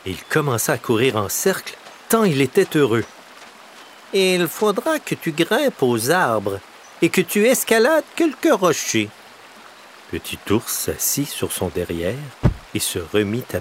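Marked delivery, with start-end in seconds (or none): 4.1-9.1 crow explaining. A narrator's reading.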